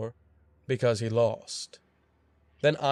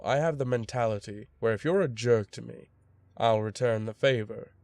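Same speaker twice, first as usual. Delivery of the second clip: plain reading voice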